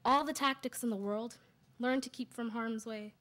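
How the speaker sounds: measured, deliberate poem reading; poetry recital. Acted. Sister's poem for brother in Cairo, measured recital. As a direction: recite it in weighted phrases with pauses.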